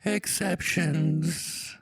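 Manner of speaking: high, slow fairy singsong; high-pitched, drawn-out pronouncement; Positivity Fairy. Pretend. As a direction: stretch every word in a high voice. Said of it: "pretty vocally high".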